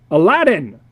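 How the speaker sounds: loud yelled name; loud angry yell for Aladdin. A narrator's reading. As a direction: bellow it out. Yelling at the room.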